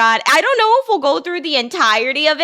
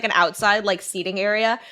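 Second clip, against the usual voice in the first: normal talking voice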